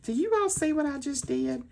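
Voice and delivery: imitating Miss E. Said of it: "she was like".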